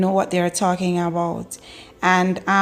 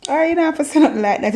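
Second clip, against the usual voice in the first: usual speaking voice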